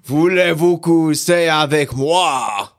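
slow, drawn-out mock French; French accent. Cohost showing off for accent challenge, slow mock French accent. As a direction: draw it out with an amateur French accent. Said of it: "Okay, French".

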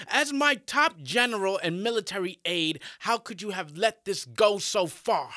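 loud, high furious reproach; angry emperor. Acted. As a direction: scold your general with fury. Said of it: "Emperor Zheng is angry and disappointed".

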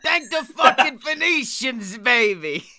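high, raspy-free gruff little New Yorker; Danny DeVito impression. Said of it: "Danny DeVito on there".

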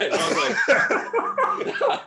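high squeal through laughter; cracking up while talking; laughing. Real, not acted. Laughing at the memory.

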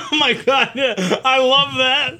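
high laughing delight. Real, not acted. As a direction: laugh it out, thrilled. Laughing at being praised.

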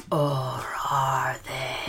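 slow ghostly whisper; low haunting voice. Acted. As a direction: draw it out slowly like a lurking ghost. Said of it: "That was a spooky voice".

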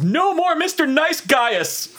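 very high, drawn-out mock holler; imitated yell. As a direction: yell it theatrically to an empty room. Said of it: "and just yells".

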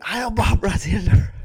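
high, slow, loud parody shout; mock salute shout. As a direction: cry it out in a drawn-out, high character voice. Corrected mock salute in character.

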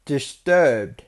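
slightly put-on accent on [name]; accidental accent. Real, not acted. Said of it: "Why did I say that with an accent?".

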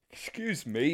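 talking through a big yawn. Real, not acted. Tired host excuses himself mid-chat, yawning. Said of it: "my big yawn".

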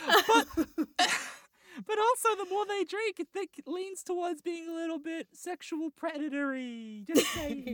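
high falsetto with laughter breaking in; mock lady. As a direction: play the prim woman in a squeaky put-on voice. Still playing the lady.

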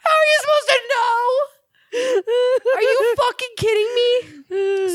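high, laughing disbelief; hammed-up incredulous retort; mock incredulity. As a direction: squeal the question in scornful amusement. Mocking his excuse in disbelief.

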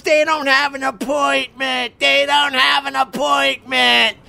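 high, shrill mock shout of a snippy clerk; mocking rude receptionist. Husband mimicking the rude receptionist, high mocking shout. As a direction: sneer it in a high put-on clerk voice. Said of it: "this bitch of a lady ... goes".

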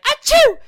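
loud, high mock sneeze; fake sneeze. Imitating the allergic girlfriend sneezing at the cat. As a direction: sneeze it out big. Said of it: "she's like".

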